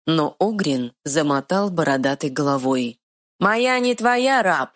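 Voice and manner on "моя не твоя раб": high, slow clumsy troll voice; stubborn dim-witted troll. Acted. Troll defiant.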